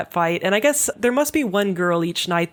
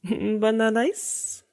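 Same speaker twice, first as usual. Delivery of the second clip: put-on cute anime girl voice; 4 semitones higher, 3 dB quieter, slower than usual. Repeats catchphrase.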